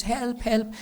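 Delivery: high-pitched mock cry for help; storyteller imitating a panicked shout. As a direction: act out panic, pitch leaping up. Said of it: "Duryodhana is panicking".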